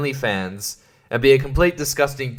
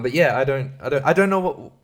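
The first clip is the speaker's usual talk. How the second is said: ordinary talk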